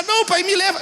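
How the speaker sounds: high mock-crying plea; retold child begging in distress; tearful pleading. Boy begs father to take him, tearful pleading. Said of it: "eu comecei a chorar".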